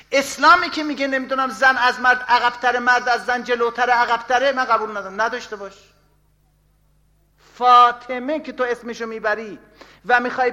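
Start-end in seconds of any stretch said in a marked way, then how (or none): 0.1-5.9 mock defiant woman, higher and louder; a hammed-up protest, pitched up; defiant woman, raised. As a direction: rail against it like the quoted woman. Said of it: "حاج خانم میگه".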